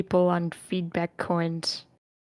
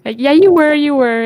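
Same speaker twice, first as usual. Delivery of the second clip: excited confirmation; 8 semitones higher, 10 dB louder than usual. Real, not acted. Host confirms timer excitedly.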